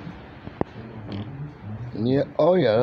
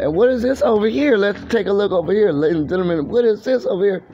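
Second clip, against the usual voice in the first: mock showman host; 7 semitones higher than usual. Mock host showing off the video.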